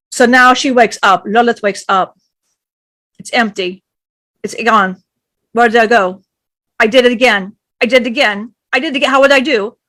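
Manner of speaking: forceful, loud dramatic retelling; loud intense storytelling. A narrator's reading. Storyteller booming to the listener, loud and intense. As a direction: drive the myth hard and loud.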